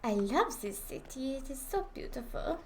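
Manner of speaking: put-on French accent. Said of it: "I must speak in French accent".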